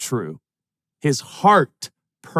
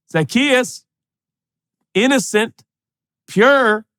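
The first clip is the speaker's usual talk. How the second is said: calling out; 9 semitones higher, 3 dB louder, slower than usual. Acted. Jesus calling up to Zacchaeus.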